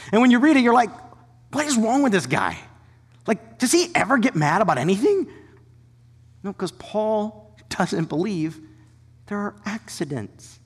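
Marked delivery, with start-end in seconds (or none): 0.1-5.3 mock disbelief. Mock baffled reader reaction.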